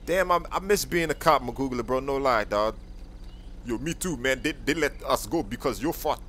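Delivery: ordinary talk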